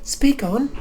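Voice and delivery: very high squeaky command; high voice try. Acted. Reader cycling voices, a very high squeaky one. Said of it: "cycle through every voice".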